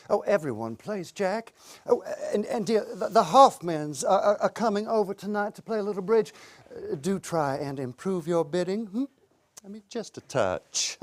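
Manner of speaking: mock a sweetly coaxing wife, hesitating over words; imitating wife. Wife coaxing husband to play bridge, imitated lightly. Voicing his wife's nagging request.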